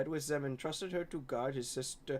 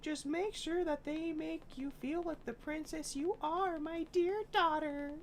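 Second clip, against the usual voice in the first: a high, lightly put-on father figure; lightly voiced loving father, pitched up; father's voice; 12 semitones higher than usual. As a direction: read the dad's advice gently, higher. Father's advice read in voice.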